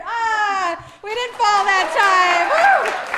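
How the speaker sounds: loud, high, drawn-out relieved cheer; breathless cry of triumph after the dance; excited relief. Real, not acted. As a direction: shout it out, thrilled nobody slipped.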